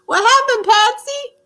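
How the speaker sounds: high, playful call of concern; high-pitched calling. Pretend. Host calling to her fallen puppet, high-pitched.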